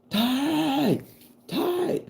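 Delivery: high, drawn-out mock yell; calling out a warning. People on the dock to the boy, alarmed yell. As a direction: call out long and very high.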